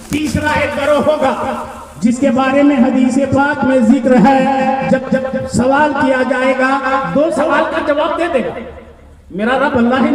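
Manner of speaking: high, insistent sermon voice; impassioned preaching. Acted. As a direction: drive each phrase out to the congregation. Preaches at full pitch.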